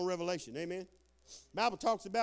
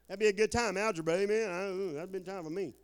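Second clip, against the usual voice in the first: ordinary talk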